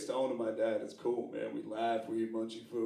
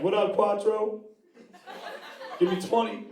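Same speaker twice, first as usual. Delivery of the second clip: hype buddy; 10 semitones higher, 5 dB louder than usual. Retold or imitated. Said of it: "He's like".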